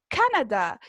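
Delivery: CANADÁ stressed on the first syllable, CA-na-da. Shown as a demonstration. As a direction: punch the CA of Canadá, English style. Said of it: "Marcamos la voz ... En la primera sílaba".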